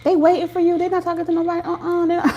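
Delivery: high, level put-on voice; playful squeaky report; high mock voice. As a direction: tease in a small high-pitched voice.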